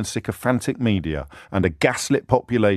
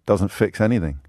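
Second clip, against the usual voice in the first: ordinary talk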